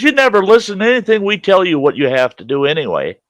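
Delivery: high, fast imitation of an exasperated manager; mock exasperation. Manager complaining that resident never listens, imitated exasperation.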